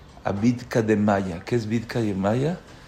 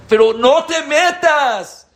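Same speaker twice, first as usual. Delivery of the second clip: slow, high, loud plea; pleading cry; 8 dB louder, slower than usual. Real, not acted. Preacher crying out to the congregation not to get involved, high and slow. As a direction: cry it out slowly to stop them.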